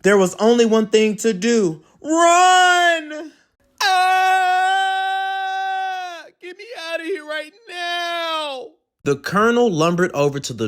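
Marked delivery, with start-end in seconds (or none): 2.0-8.7 high, loud frightened cry; terrified yelp for help; panicked puppy. Acted. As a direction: scream it while running away.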